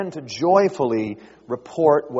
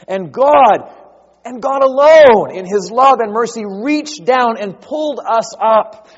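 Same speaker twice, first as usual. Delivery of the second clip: louder, higher proclaiming, slowing on key words; passionate preaching; 7 semitones higher, 9 dB louder than usual. Acted. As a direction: drive the rescue home with fervor.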